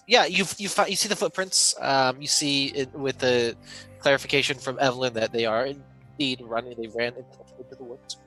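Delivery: ordinary delivery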